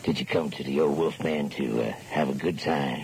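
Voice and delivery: low, husky-free crooning DJ voice; seductive late-night DJ. Acted. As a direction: lean in low like a lounge lizard on air.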